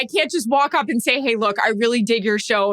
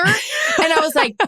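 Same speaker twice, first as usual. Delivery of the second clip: a higher, slower lead-in that bursts out laughing; laughing shout; 7 semitones higher, slower than usual. Real, not acted. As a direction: crack up mid-sentence. Laughing at his own story.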